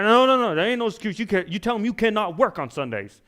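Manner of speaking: high, loud scolding; indignant church lady. Retold or imitated. Old church lady telling a young waitress she can't work Sundays, loud high scold. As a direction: refuse every excuse, loud and high. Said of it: "church lady scolding waitress".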